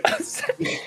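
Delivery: apologizing through laughter; giggling, barely getting words out; laughing. Real, not acted. Laughing at silly team name.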